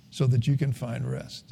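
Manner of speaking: hushed echo of the phrase. Shown as a demonstration. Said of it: "repeats line softer".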